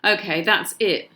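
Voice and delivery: a mother at the end of her patience; cried out. Acted. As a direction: mum loses patience with all the dinosaurs. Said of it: "my mum cried".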